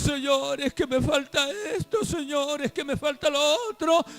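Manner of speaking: high, whiny mock prayer; mock self-pitying plea. Preacher mimics believers begging God through self-pity, high mock lament. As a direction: plead pitifully, imitating a sorry-for-himself believer. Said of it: "a través de nuestra lastimería".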